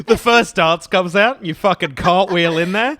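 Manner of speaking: loud teasing build-up; half-laughing loud tease; mock hype. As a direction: hype up the wild entrance. Teasing about wedding dance.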